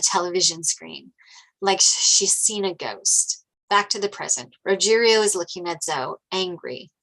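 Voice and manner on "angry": ordinary talk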